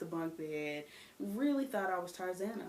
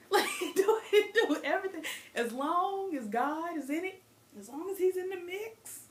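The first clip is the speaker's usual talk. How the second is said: higher, louder gleeful permission; mock enthusiasm; 10 semitones higher, 5 dB louder than usual. Mocking church-approved freedom.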